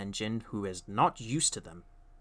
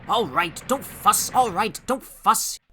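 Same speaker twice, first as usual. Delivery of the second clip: grumbling; 8 semitones higher, 5 dB louder, slower than usual. A narrator's reading. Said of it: "grumbled the trucks".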